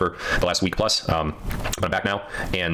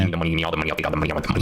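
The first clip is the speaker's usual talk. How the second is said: mock greedy movie line; 3 semitones lower, much faster than usual. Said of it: "I think it's from a movie".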